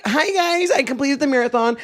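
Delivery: high, chirpy mock vlogger opener; re-enacted bubbly hello to camera; peppy vlog greeting. Retelling own cheery vlog greeting.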